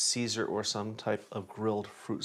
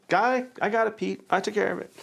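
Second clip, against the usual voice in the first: cleaner's voice; 10 semitones higher than usual. Retold or imitated. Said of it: "she's like".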